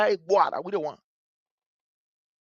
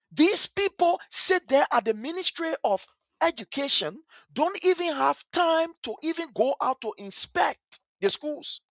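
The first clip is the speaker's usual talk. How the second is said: pitched-up, heated complaint; angry rant; 6 semitones higher than usual. Real, not acted. Angry educator scolding ministry officials who never inspect schools, heated high rant. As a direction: let the anger rise as you accuse them.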